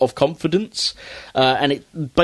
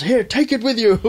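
high, huffy cartoon pig voice with a laugh; fed-up shopkeeper pig; 8 semitones higher than usual. Porkrind handing the boys the arcade game, in a high huffy voice. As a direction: give the game away in disgust. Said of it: "rips it off the wall and goes".

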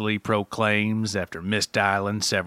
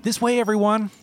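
cheery call; 10 semitones higher, 3 dB louder than usual. A narrator's reading. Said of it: "a cheery voice utters".

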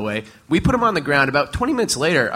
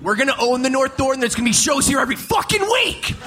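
hyped shout; 7 semitones higher than usual. Real, not acted. Bold hype claim.

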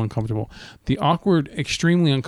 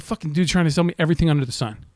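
ordinary talk

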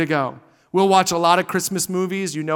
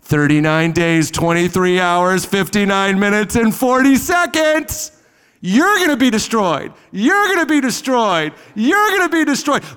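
gloating countdown; 4 dB louder than usual. Retold or imitated. Preacher mocking Jonah's countdown to Nineveh, gloating louder. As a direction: rub in the doom, building to a yell. Mock countdown taunt, building.

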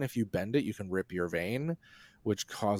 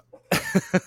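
high squealing laugh; 8 dB louder than usual. Real, not acted. Embarrassed laugh at innuendo.

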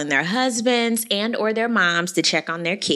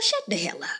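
muttered aside; 3 semitones lower, 8 dB quieter than usual. Pretend. Telling Giselle off aside.

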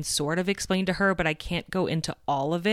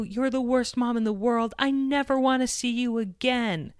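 high mock shout of an angry child; imitated child shouting; 7 semitones higher than usual. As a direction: hurl it like a furious seven-year-old. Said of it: "she started shouting".

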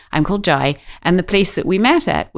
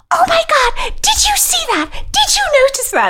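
much higher voice, an excited friend gasping in wonder; astonished friend. Retold or imitated. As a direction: squeal it like a thrilled girlfriend. Best friend astonished after the moment.

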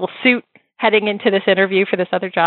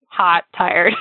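ordinary talk, nothing marked

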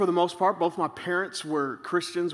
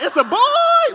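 excited doctor shout; 8 dB louder, slower than usual. Retold or imitated. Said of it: "He's like".

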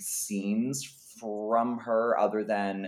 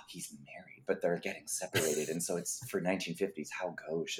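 mock scandalized; 4 semitones lower, 9 dB quieter than usual. Mock scandal at old film plot.

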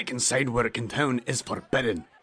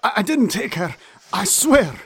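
pleading; 7 semitones higher, 6 dB louder than usual. Acted. Thug begging for mercy.

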